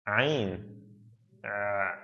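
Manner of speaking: "Ain", then "Uh" held from the throat; Arabic Ain sound. Shown as a demonstration. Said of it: "no English word that contains this sound".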